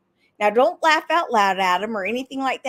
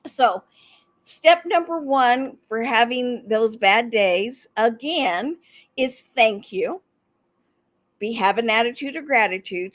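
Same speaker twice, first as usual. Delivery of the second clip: ordinary talk, nothing marked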